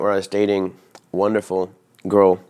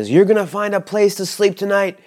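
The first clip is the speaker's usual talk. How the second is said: much higher, louder, yelled threats; retold furious yelling; 13 semitones higher, 4 dB louder than usual. Angry host yells threats after the departing guest, furious. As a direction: shout down the stairs like an enraged host. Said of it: "And he yells after me".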